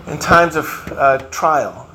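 ordinary delivery

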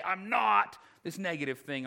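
"not" punched out hard; serious declaration with heavy stress on "not"; emphatic negation. Retold or imitated. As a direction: hit "not" hard, then carry on. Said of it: "The most serious way he could share this".

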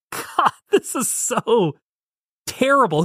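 high, drawn-out mock dismay; exaggerated high-pitched exasperation; appalled reaction. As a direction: groan it high and slow, appalled. Acts out own appalled reaction.

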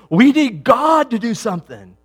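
high, loud mock-desperate cry; urgent outcry. Preacher voicing what we say many times, loud urgent plea. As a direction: cry out as if begging for rescue. Acted plea of people in crisis.

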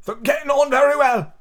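high, strained choked squeal; choking voice. A narrator's reading. Hatta answering with bread in his mouth, choking high voice. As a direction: squeeze it out as if choking on food. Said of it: "he said in a choking voice".

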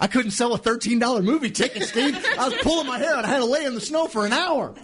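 hammed-up self-pitying whine; a playful high moan about failing; mock whine. Self-mocking complaint amid laughter.

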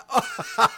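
a real laugh at the joke setup; short laugh. Real, not acted. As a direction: laugh before the joke lands.

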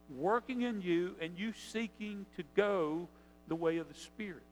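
ordinary delivery

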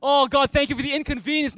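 absurdly grateful mock prayer; mock gushing thanks. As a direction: overdo the thankfulness to show it's absurd. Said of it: "you do absurd things like".

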